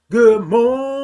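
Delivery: loud, stretched-out good morning; called-out greeting. Real, not acted. Streamer greeting a follower as they join, called out. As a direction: sing it out with a long 'morning'. Sing-out greeting to live viewers.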